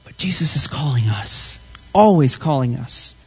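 low near-whisper; hushed reassurance. Real, not acted. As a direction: drop to a whisper for the congregation. Preacher closing with reassurance.

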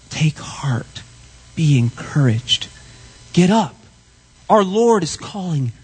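low whisper to the congregation; whispered encouragement. Real, not acted. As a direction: whisper it as reassurance. Preacher softly exhorting the church.

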